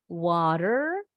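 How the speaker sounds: Water in American accent, wah-der with a final R; American Water with tapped T and sounded R; American pronunciation. Shown as a demonstration. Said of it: "orang Amerika masih bunyi R-nya". (Water logat Amerika, R di akhir berbunyi)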